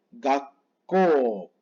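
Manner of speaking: Gakko with a stop before "ko" and a long O; long final O. Shown as a demonstration. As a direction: hold the last vowel of Gakko. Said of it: "es gakko con doble O".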